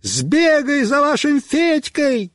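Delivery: high, stretched-out order; urgent high holler; shouting. A narrator's reading. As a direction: yell at a dim friend to run for help. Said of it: "Я кричу этому дуралею".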